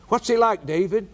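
mocking heathen question.

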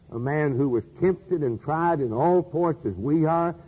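normal talking voice

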